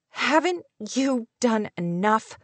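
slow, halting, understated sobbing words; a touch of tearful reproach, word by word; mildly acted sob, each word set apart; hiccuping sob. A narrator's reading. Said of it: "on the end of a hiccuping sob".

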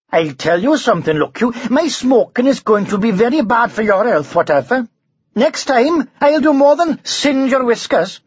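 high lilting Welsh threat; menacing Welsh dragon. A narrator's reading. Dragon threatens the knight, lilting Welsh. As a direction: warn him in a sing-song Welsh lilt. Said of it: "a lilting sing-song voice".

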